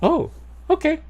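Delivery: a sudden squeaky high "oh, okay"; high squeak. Retold or imitated. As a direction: squeak it out, caught off guard. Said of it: "And I'm just like".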